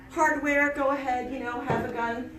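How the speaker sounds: ordinary talk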